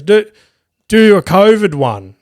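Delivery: lightly voiced drunk guy calling out a request; a hint of a tipsy punter shouting from the crowd; drunk heckler. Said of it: "this drunk dude".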